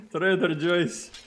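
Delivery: high, slow, loud "Joyce" for Joe's; funny mispronunciation. Shown as a demonstration. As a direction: savour it slowly, Joe's as JOYCE. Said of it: "I'll forever call it".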